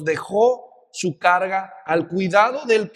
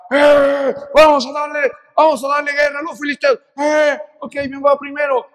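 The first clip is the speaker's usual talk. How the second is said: higher, loud rallying yell; boastful war cry, then a nervous handoff; battle cry shout; 6 semitones higher, 6 dB louder than usual. Retold or imitated. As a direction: hype up the troops at full voice. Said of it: "todos los israelitas dice que gritaban".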